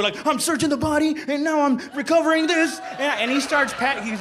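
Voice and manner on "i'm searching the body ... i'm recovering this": lightly acted eager sergeant, pitched up; keen sergeant. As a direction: narrate the search as the keen sergeant. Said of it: "he's all into it".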